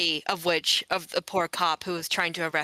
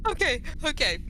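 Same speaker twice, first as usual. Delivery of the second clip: laughing; 8 semitones higher than usual. Real, not acted. Recovering from laughing fit.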